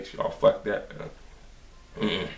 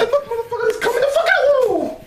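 very high, louder screaming half laugh half cry; shrieking panic, laughing and crying; terrified scream; 4 dB louder than usual. Real, not acted. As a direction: scream it in horror while laughing. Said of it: "I'm screaming like a little bitch".

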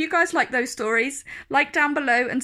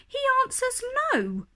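lion's reply; 13 semitones higher, much faster than usual. Acted. Lion's answer voiced in poem.

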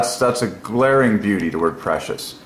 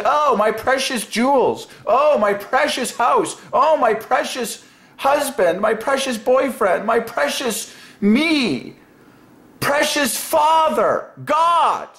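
much higher, slow, doting mock voice; mocking what people say; 13 semitones higher, slower than usual. Imitating people who cherish things over God, in a high, slow mock coo. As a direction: coo over your possessions like a fool. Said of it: "People say".

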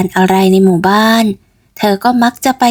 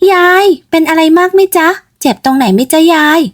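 startled concern; 8 semitones higher than usual. Acted. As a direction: check the old lady is hurt, flustered. Ked checks on old woman. (เกดถามยายด้วยความตกใจ เสียงสูงและดังขึ้น)

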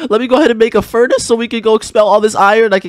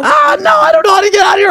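panicked cry. Acted. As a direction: cry out like you're trapped. Mom chasing them, panic.

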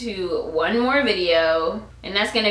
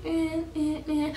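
soft mumbling; 4 semitones higher, 9 dB quieter, slower than usual. Retold or imitated. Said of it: "I'm speaking really soft".

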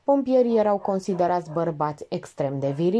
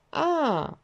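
disappointed; 9 semitones higher than usual. A narrator's reading. Elena hears whom Georgeta means, disappointed. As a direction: let down, on a long falling sigh of a word. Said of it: "făcu decepționată Elena".

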